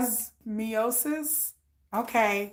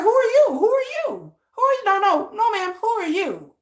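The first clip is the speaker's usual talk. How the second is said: mock indignant; 8 semitones higher than usual. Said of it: "I'll be like".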